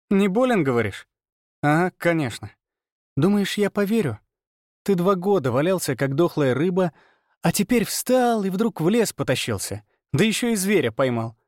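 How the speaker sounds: high, sarcastic disbelief; scornful scoffing. A narrator's reading. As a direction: taunt with a contemptuous smirk. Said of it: "Она фыркнула, скрестив руки на груди".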